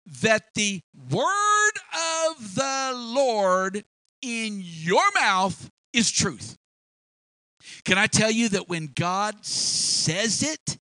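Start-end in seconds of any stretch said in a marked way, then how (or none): 0.1-6.5 slow and loud, every word weighed; slow emphatic preaching. Acted. Widow to Elijah, slowly hammering each word.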